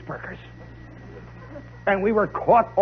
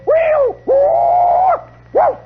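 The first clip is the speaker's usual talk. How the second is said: high, loud whistle-like whoops; imitated quick whistle; 7 dB louder, much slower than usual. Whistle sends band into a counter march, high piercing whoops. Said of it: "There's a quick whistle".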